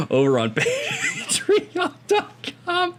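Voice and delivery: high, slow, squeaky with laughter; can barely get the plug out for laughing; laughing through it. Real, not acted. Host laughing through the Patreon plug, high and strained.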